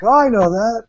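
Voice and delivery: high, loud delighted recognition; sudden excited "oh, I know that"; surprised recognition. Retold or imitated. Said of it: "and I'm like".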